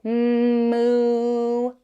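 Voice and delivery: low, long mooing cow. Pretend. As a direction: moo like a lazy cow. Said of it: "A cow says".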